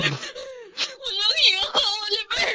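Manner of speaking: tearful angry outburst; a mother screaming at the man who took her child; crying rage. Acted. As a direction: hurl it at him through sobs. Mother confronting ex-husband, crying.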